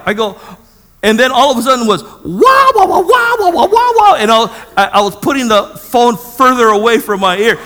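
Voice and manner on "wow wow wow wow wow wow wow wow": very high, loud wah-wah babble; imitated cursing tirade. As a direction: mimic an angry tirade blaring down the phone. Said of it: "She was cursing me out".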